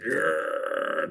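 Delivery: long growling "arr", no real voice; decrepit old-man groan. Retold or imitated. Mimicking the barely alive old husband, drawn-out growl. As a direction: groan like you're clinging on to life. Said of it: "just barely looking like he's clinging".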